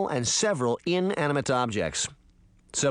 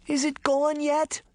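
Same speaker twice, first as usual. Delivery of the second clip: put-on scared little girl; 14 semitones higher than usual. Scared girl under covers.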